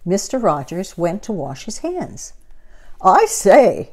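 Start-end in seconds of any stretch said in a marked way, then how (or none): 3.0-3.9 louder call. Acted. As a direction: call from the bathroom. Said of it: "he called".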